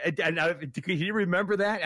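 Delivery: usual speaking voice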